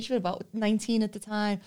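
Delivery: usual speaking voice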